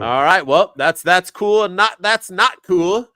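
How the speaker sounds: high, slow Carlito-style catchphrase; lightly done sexy Carlito drawl. Retold or imitated. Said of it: "our man Carlito giving us his sexy voice".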